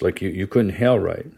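usual speaking voice

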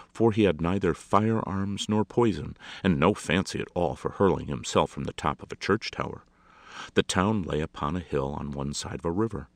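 plain reading voice

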